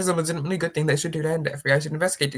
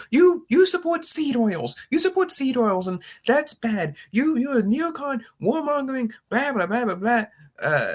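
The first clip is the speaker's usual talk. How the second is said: hammed-up indignant critic piling on insults; mocking outrage; 7 semitones higher than usual. Parodying angry online followers, higher mock outrage.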